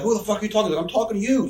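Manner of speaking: high, heated retold retort; mock belligerent. Hot-headed guy squares up to her, mock belligerent. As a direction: imitate a hothead squaring up. Acted backstage quarrel, his retort.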